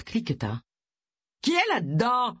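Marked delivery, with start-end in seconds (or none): 1.4-2.4 high, indignant thundering demand; raised-voice booming challenge. A narrator's reading. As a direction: thunder it through a closed door. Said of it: "tonna la voix de Gilles".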